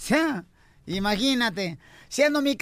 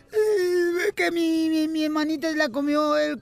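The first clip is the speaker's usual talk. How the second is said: put-on crying child; 3 semitones higher than usual. Child telling mamá about his little sister, mock blubbering. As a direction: blubber it like a tearful little boy. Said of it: "¿Por qué está llorando".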